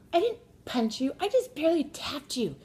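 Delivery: high, loud protest; mock indignant denial. Daughter telling her brother she barely tapped him, indignant mock yell. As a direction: deny it loudly like a squabbling kid. Acted daughter denying the punch.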